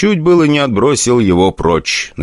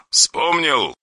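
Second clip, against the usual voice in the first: quiet, pointed question; soft cold menace; 9 semitones higher, 5 dB quieter than usual. Acted. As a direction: press him coldly. Caller demands.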